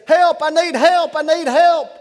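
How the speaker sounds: high, loud panicked yell for help; scared cry for help. Retold or imitated. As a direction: shout for help like the lion is on you. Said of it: "the man now gets really scared".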